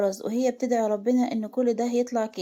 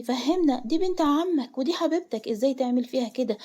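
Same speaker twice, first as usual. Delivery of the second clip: higher, pressing, indignant questions; angry demand; 3 semitones higher, 4 dB louder than usual. Acted. Father demanding his silent son explain himself, indignant. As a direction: scold him and push for an answer.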